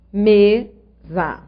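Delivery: Mesa split into me and sa with a clear pause; syllable by syllable. Shown as a demonstration. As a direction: sound out "Mesa" as two separate syllables. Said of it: "vou dividir as sílabas da palavra".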